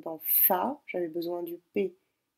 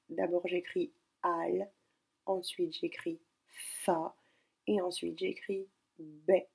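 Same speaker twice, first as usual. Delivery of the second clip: slow, syllables spelled out one by one; 4 dB quieter, slower than usual. Shown as a demonstration. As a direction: dictate each syllable slowly. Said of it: "écrire syllabe par syllabe". (syllabe par syllabe, lentement)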